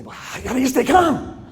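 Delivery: a parent asking in disbelief; mock exasperated parent; puzzled parent. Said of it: "so people say".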